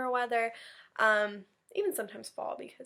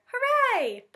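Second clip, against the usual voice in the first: much higher, louder joyful cheer; cheering Hooray; 9 dB louder than usual. Acted. Teacher voicing Rabbit cheering for the class, much higher and louder. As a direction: shout it with delight.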